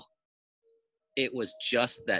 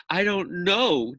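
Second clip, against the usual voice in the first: slow, higher, lightly sobbing; mock sob; 7 semitones higher, 4 dB louder, slower than usual. Dana answers Myron, lightly sobbing and slow. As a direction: draw it out like a tearful girl. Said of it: "Dana sobbed".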